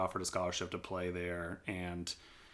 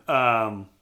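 normal talking voice

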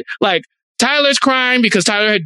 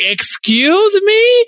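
high, drawn-out mock-shocked question; indignant disbelief; 11 semitones higher, much slower than usual. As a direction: stretch it out, rising in offended disbelief. Acting out host's shocked reply.

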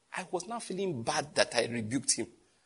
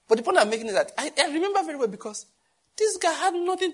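fast, higher, laughing; laughing through it; 12 semitones higher, 3 dB louder than usual. Real, not acted. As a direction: rush it out amused. Laughing while retelling.

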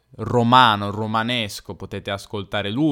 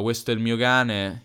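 ordinary delivery

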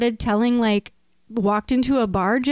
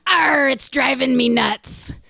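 pirate voice; slower than usual. Acted. Pirate punchline.

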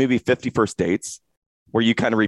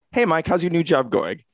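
same question again, pitched up and cheery; repeating it brightly, higher; 8 semitones higher than usual. Retold or imitated. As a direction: ask it fresh as if for the first time, a bit higher. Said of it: "And then thirty seconds later".

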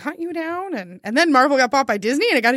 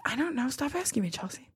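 very soft, dismissive mutter; muttered brush-off; 13 dB quieter than usual. Retold or imitated. Alan fending off questions, quiet muttered brush-off. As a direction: mutter it under your breath, imitating him.

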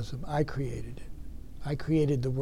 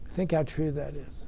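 ordinary talk

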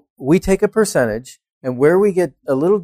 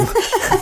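high laugh. Real, not acted. Joke about greasing palms.

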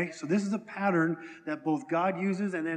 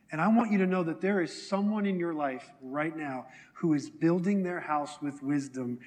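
ordinary delivery

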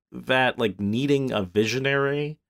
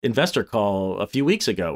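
ordinary talk

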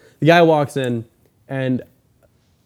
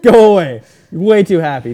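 loud brush-off; 5 semitones higher, 10 dB louder than usual. Pretend. Pretend rude reply to salesman.

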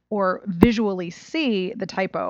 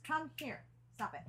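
much softer, off to the side; aside to the dog; 6 semitones higher, 22 dB quieter than usual. Real, not acted. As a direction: quietly order a pet over. Turns aside to command the dog.

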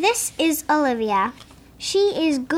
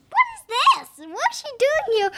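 much higher, puzzled exclamation; surprised; 10 semitones higher than usual. Real, not acted. Kid surprised at a picture in the book, high surprised. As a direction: squeak out the surprise.

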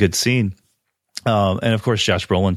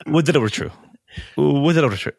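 crisp, clipped-free light English reading, raised pitch; proper English delivery; 6 semitones higher, faster than usual. Shown as a demonstration. Host showing how the line should sound, light English lilt. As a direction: say it the polished way the director wants. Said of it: "the way the line's supposed to go is".

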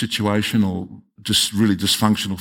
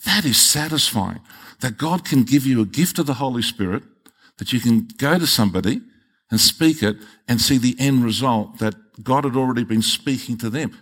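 usual speaking voice

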